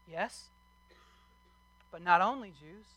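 ordinary talk, nothing marked

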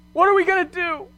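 retold anxious outburst; scared and nervous. Wife to husband while driving through the tunnel, mock scared and nervous. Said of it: "really scared and really nervous".

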